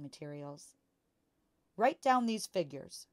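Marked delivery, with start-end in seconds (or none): 1.8-3.1 dictating father. A narrator's reading.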